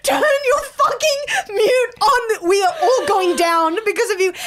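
high, loud retold desperate plea, stretched out; freaking-out plea. Comedian privately messaging the unmuted guy, hammed-up frantic plea. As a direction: beg frantically, playing up the panic. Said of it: "I'm freaking out".

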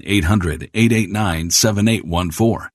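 normal talking voice

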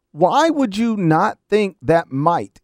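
slow, deliberate question landing hard on "might"; stress on MIGHT. Real, not acted. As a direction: draw the question out and punch "might" at the end. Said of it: "emphasis on the word might".

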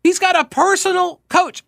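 very high-pitched disbelief; incredulous. Real, not acted. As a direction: exclaim it high in astonishment. Still disbelieving about the coach.

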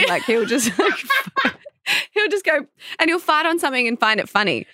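high-pitched, giggly storytelling; laughing while talking. Real, not acted. Mother telling co-host the fart story, cracking up. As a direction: tell it through fits of laughter.

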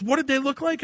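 high wife voice, quizzing; voicing the wife high. Wife questioning, acted quote.

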